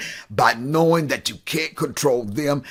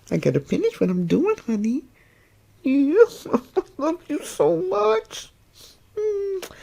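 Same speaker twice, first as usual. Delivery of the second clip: cooing to pet. Real, not acted. Owner cooing baby talk to her dog on her lap. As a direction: coo lovingly to the dog, much higher.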